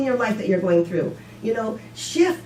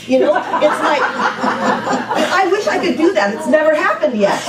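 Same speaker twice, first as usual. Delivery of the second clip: laughing; 5 semitones higher, 5 dB louder, slower than usual. Real, not acted. Laughing at own meltdown.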